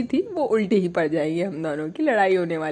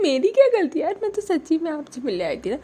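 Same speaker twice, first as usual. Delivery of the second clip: pleading whine; 9 semitones higher than usual. Retold or imitated. Girlfriend pleads innocence.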